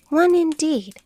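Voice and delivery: high, loud indignant outburst. Acted. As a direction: snap it back in offended disbelief. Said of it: "said the Dormouse indignantly".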